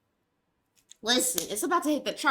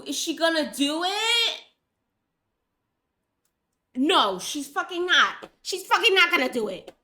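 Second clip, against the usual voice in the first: louder and higher, yelling at the screen; frustrated yelling; 5 semitones higher, 4 dB louder than usual. Real, not acted. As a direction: yell at the frozen program. Yelling at frozen software.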